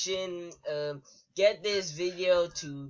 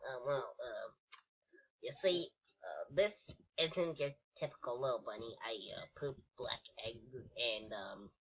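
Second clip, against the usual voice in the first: low, soft, hesitant bunny; 5 semitones lower, 8 dB quieter than usual. Pretend. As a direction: play a little bunny answering quietly, with ums. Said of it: "who's actually been doing the, uh, bunny voice".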